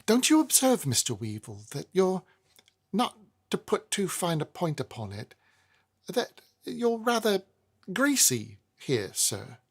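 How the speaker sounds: a polite, fretful little tradesman; Snagsby voice. A narrator's reading. Snagsby sniffing the air to Weevil, mild and timid.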